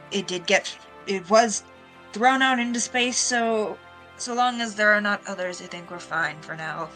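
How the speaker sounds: ordinary talk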